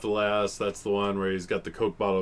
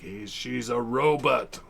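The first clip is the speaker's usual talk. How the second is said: slow mock officer voice; 4 semitones higher, slower than usual. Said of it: "She's like".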